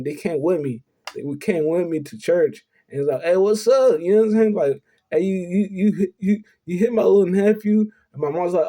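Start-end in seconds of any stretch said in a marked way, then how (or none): 3.2-4.5 higher retold challenge; confrontational uncle. Uncle confronts the pastor at church. 5.1-7.9 raised, stammered mock accusation; confrontational uncle. Uncle confronts the pastor at church.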